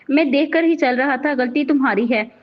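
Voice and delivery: ordinary delivery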